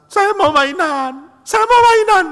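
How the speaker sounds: mock toddler bawling, high and drawn out; hammed-up tantrum cry; wailing child. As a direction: build a fake crying whine into a loud wail. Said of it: "Dia mulai coba nangis".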